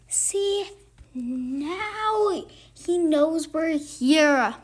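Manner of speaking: slow, drawn-out monster sigh; a hint of weary monsters letting air out. Monsters under the bed caught out, slow sigh. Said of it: "the voices sighed".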